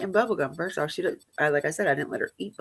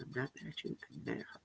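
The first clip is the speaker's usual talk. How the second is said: hushed unvoiced whisper to the manager; a pointed whispered aside; 4 semitones lower, 21 dB quieter, much faster than usual. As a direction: whisper it aside so the child can't hear. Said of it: "I looked at him and I went like this".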